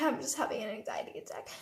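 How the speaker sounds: acted weak, panicky whisper; breathless whisper. Panicking girl to worried roommates, breathless acted whisper. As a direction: whisper it as if too anxious to speak. Telling girls she is panicking.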